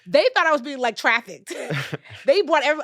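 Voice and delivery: real laughter bubbling through; laughing. Real, not acted. Laughs recalling bank's suspicion.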